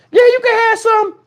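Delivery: put-on high, sweet little-girl voice. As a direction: play the kid giving in. Daughter voiced replying.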